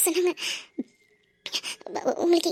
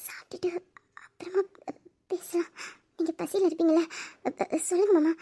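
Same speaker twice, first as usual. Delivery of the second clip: hushed, bashful offer of food; shy whisper; 10 dB quieter than usual. Acted. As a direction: whisper it shyly to her husband. Shy wife pleading softly. (வெட்கத்துடன் கிசுகிசுப்பு)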